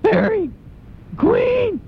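"Fairy" i "Queen" piszczane bardzo wysoko, jękliwie; high whiny "Fairy", "Queen". Shown as a demonstration. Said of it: "say fairy and queen in that whiny voice".

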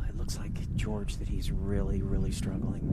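soft, low hushed broadcast voice; golf commentator. Acted. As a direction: murmur it like a golf announcer.

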